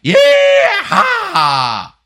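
huge high, loud cowboy holler; full-throated Tennessee cowboy shout; Texas cowboy yeehaw. Shown as a demonstration. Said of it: "like a Texas or Tennessee sort of cowboy thing".

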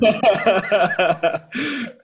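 real loud burst of laughter. Real, not acted. Laughing at burp joke.

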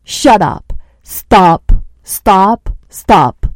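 shouted commands, loud and forceful; yelling stop at the inner critic; firm shouting. Shown as a demonstration. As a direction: shout it down hard, no room for argument. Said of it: "be very firm with it and be like".

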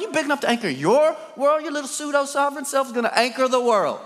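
high-pitched rhetorical grilling; incredulous challenge. Real, not acted. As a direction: pile up disbelieving questions. Preacher challenging listeners' self-sufficiency.

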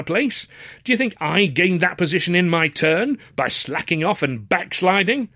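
high, indignant tirade; berating father. A narrator's reading. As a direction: lecture an idle son in outrage. Father berates his son.

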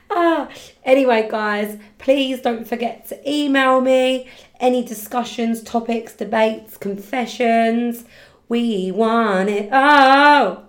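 loud and upbeat; excited. Real, not acted. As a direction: hype up the listeners. Enthusiastic outro to listeners.

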